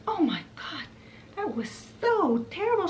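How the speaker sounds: high, far-off fairy voice, rising sharply; frazzled Merryweather. Retold or imitated. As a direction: gasp it out like a shaken little fairy. Merryweather voiced after ordeal.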